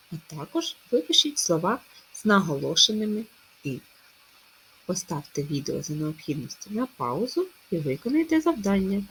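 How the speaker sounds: ordinary talk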